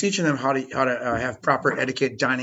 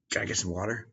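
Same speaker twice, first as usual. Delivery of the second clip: meek student asking; 7 semitones lower, 14 dB quieter than usual. Retold or imitated. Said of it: "the antithesis of that would be".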